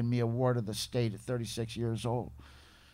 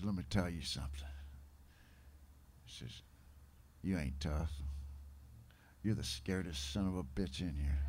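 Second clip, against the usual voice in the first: put-on gravelly old-timer; 6 semitones lower, 9 dB quieter than usual. Old-timer tells the tough newcomer he's scared, low gravelly voice. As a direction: lean in close and growl it low and soft. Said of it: "this deep, gravelly voice".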